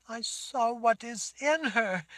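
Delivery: put-on high, slow, tearful girl's voice; a frightened girl's sob; sobbing girl. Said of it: "she sobbed".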